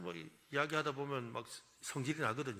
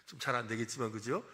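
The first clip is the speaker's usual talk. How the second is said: ordinary talk, nothing marked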